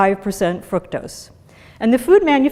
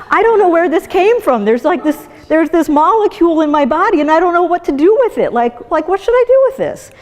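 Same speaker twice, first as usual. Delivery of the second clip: higher, slightly louder, puzzled and hurried; the body baffled by a strange molecule; confused body; 7 semitones higher, 4 dB louder than usual. Retold or imitated. Body facing unknown fructose, mock bewildered. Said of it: "your body just goes".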